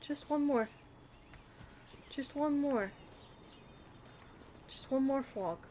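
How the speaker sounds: little wheedling chant, stress on one; pleading sing-song. Pretend. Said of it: "I don't know why I'm saying it like that".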